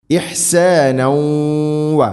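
ഇഹ്സാനൗ വ with a long nasal hum gliding into "wa"; nasal merging. Shown as a demonstration. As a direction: hum the end of ഇഹ്സാനൗ through the nose into വ. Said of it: "pitch മണിച്ചുകൊണ്ട് ഉച്ചരിക്കണം".

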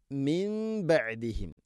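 മിൻ ബഅദിഹിം with the nun turned to m, nasalised; iqlab demo. Shown as a demonstration. Teacher showing iqlab to students, nasal m. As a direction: say മിൻ ബഅദിഹിം as "mim-ba'dihim", humming the m. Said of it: "നൂനിനെ മീമാക്കി മാറ്റണം".

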